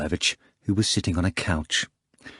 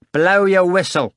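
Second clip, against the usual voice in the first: high, loud command; barked order; 8 semitones higher, 6 dB louder than usual. A narrator's reading. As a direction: bark it out as a sharp order. Commander's final order to the doorman.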